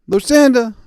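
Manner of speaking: loud, high shout across the office; calling out a name. Retold or imitated. Clerk hollering for her colleague across the office, loud. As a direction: yell for a coworker.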